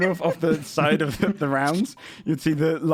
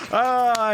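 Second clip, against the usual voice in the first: gasp, then a loud high "ah"; amazed audience gasp and cry; crowd gasp; 10 semitones higher, 5 dB louder than usual. Acted. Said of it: "then the Hulk goes".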